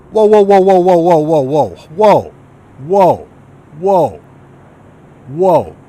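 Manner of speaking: loud, startled string of whoas fading out; yelling in alarm, then calming down. Real, not acted. Streamer spotting danger in the game, alarmed loud whoas. Sudden threat on the map.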